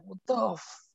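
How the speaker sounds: high-pitched retold incredulity; mock disbelief. Comedian imitates shocked friends, mock disbelief. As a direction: imitate someone appalled, stretching it out.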